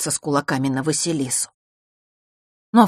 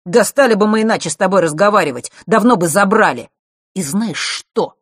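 loud, raised, irritated tirade; sudden anger; 5 semitones higher, 8 dB louder than usual. A narrator's reading. Mark turns on Vasilisa in sudden anger. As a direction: let the temper flare. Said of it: "вдруг разозлился Марк".

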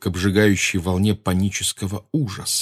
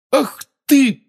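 angry outburst; 6 dB louder than usual. A narrator's reading.

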